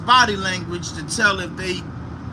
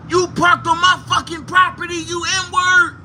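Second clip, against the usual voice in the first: high-pitched mock yell; angry confrontation; 9 semitones higher than usual. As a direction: imitate an irate man accusing a trespasser. Retold angry man confronting him.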